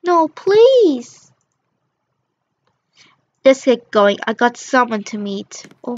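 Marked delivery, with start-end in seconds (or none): 0.0-1.3 high, loud frightened plea; pleading. Acted. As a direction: beg the bully not to hurt you. Begging the bully.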